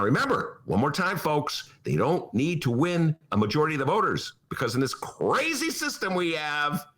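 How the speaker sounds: ordinary talk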